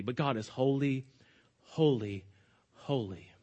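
low, soft, reverent triple "holy"; solemn hushed repetition. Real, not acted. Threefold holy as the solemn climax.

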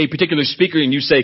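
normal talking voice

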